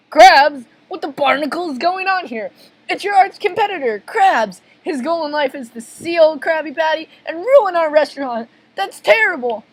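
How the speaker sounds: high, agitated exclamations; alarmed cartoon voices. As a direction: react with breathless cartoon alarm. Alarmed exchange about arch competitor.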